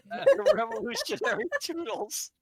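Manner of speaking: high, laughing through the words. Real, not acted. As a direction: squeal it while cracking up. Giggling fit after the name.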